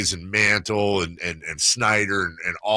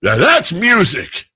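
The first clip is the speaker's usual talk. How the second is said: loud, high, drawn-out imitation; slow, booming mock-dad verdict; approving dad; 11 semitones higher, 11 dB louder, slower than usual. Dad praising his favourite record, loudly emphatic. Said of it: "He was like".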